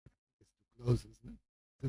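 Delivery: usual speaking voice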